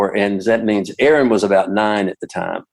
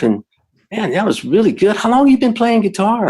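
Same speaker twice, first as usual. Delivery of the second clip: lightly imitated teen fan, pitched higher; star-struck kid heaping praise; gushing teenager; 9 semitones higher than usual. Teen fan gushing over performance.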